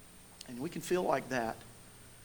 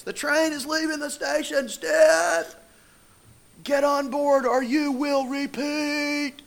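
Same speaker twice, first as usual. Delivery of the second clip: high, loud drill-instructor bellow, stretched at the end; Randy Brewer screaming; 10 semitones higher, 6 dB louder, slower than usual. Retold or imitated. Coach threatening a sick runner with repeats, screaming. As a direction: imitate a coach hollering across the track. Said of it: "Coach Brewer is screaming at me".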